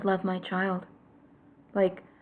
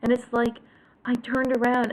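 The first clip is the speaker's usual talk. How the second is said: ordinary talk, nothing marked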